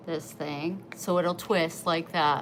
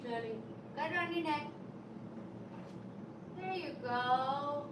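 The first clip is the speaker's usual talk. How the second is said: soft, high, cooing encouragement to the cat; pet talk; 4 semitones higher, 14 dB quieter than usual. Real, not acted. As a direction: coax the cat to play, sweet and soft. Talking to her pet.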